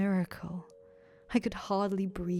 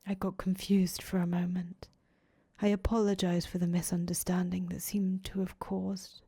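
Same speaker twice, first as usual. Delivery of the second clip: whispered narration. A narrator's reading.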